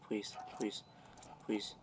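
soft desperate pleading; quiet begging. Real, not acted. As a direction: beg softly, under your breath. Begging while dying.

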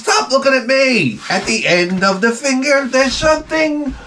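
high, slow rising exasperated rant; high yelling at the dog, slowing at the end. Retold or imitated. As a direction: build up to a high frustrated yell. Scolding the dog.

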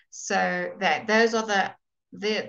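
usual speaking voice

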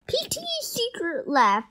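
ordinary delivery

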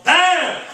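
shouted high "bam"; loud exclamation. Shown as a demonstration. Teacher marks the accent with a loud bam. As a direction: punch it out like an impact. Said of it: "Atención al acento".

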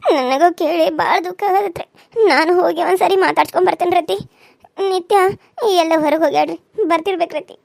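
put-on younger relative's voice, saddened; acted distress, addressed respectfully to an elder; saddened daughter-in-law. Daughter-in-law asking mother-in-law to let her visit, saddened.

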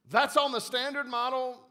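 the angel can't believe it's standard; surprised question. A narrator's reading. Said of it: "asked the angel".

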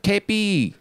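high, drawn-out mock exclamation. Shown as a demonstration. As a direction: call it out like a slang exclamation. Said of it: "you use it like O M G".

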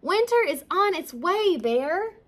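high, loud warning; crying out. A narrator's reading. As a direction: cry the news out to rouse someone. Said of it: "cried Ladybug".

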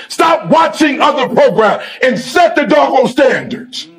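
shouted, slowed, hammering each command; preaching at full force. Acted. Speaker demanding the team set the standard, shouting. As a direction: drive every 'stop' home like a preacher. Rallying speech to players.

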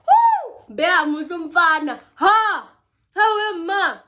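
thrilled cheering about how fine he is; excited shouting. Real, not acted. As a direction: swoon out loud over a handsome guy. Gushing over handsome guy.

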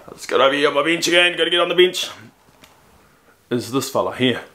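ordinary delivery

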